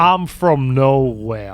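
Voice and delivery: slow, drawn Southern drawl; hammed-up slow Southern accent; mock Foghorn Leghorn accent. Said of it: "foghorn leghorn".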